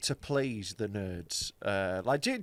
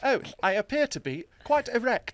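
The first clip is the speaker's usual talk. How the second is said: fussy robot butler voice, higher pitched; C-3PO; 7 semitones higher than usual. Acted. The droid caught in a sex scene, fussy impression. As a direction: put on a prim, flustered droid. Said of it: "a guy in a C three P O suit".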